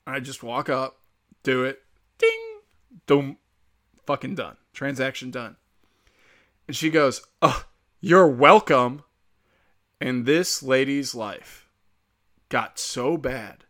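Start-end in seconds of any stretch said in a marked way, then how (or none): 2.2-2.6 very high, held "ding"; imitated machine ding. Self-checkout machine finishing the transaction, high imitated ding. 7.4-9.0 loud, drawn-out mock "you're welcome"; sarcastic rebuke. Woman to the man she let ahead, sarcastically. Retold snarky rebuke after letting him ahead.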